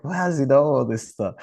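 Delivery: an impressed wow, high; amazed. Retold or imitated. Amazed at the young man's knowledge.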